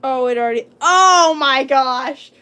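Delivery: sudden loud cry of dismay; loud outburst. Real, not acted. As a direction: blurt it out way too loud. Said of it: "I'm being way too loud".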